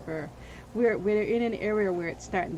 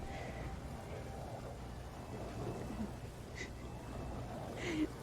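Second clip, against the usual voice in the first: quiet unvoiced rising laugh; breathy laugh; 5 semitones higher, 14 dB quieter than usual. Real, not acted. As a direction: laugh it off softly. Laughs at own mood swings.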